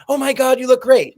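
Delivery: high, loud gushing praise. Retold or imitated. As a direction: gush like a delighted fan. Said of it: "And then".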